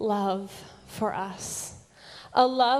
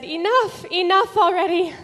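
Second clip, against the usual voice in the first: high, loud burst of protest; raised, fast and emphatic; exasperated outcry; 7 semitones higher, 7 dB louder, faster than usual. Acted. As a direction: cry out to stop it at once. Imagines God halting the crucifixion.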